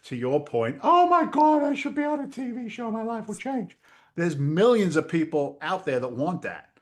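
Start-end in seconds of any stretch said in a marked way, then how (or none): 0.8-3.8 high, gushing parody of a dreamer; squealing mock excitement; mock starry-eyed wannabe. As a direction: lampoon the TV-show fantasy. Mocking wannabe marketers' fantasy.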